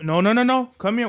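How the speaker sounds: usual speaking voice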